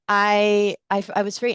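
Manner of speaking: normal talking voice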